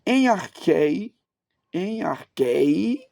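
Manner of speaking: "em arquei" slowly, rising on the last syllable; "em arquei" in slow, teacherly syllables; didactic Greek reading. Shown as a demonstration. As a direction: draw out "arquei", stressed on its final "ei". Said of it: "Eu vou pronunciar do jeito que mais fácil".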